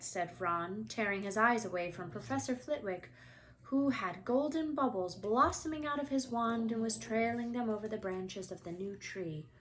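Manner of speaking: usual speaking voice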